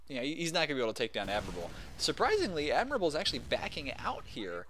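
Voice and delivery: usual speaking voice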